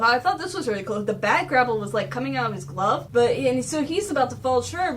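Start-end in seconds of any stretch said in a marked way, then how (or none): none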